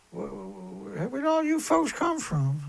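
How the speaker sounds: squeaky high fake amazement; mock surprise. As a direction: gasp at a sudden crowd, hammed up. Mocking popular streamers' fake surprise.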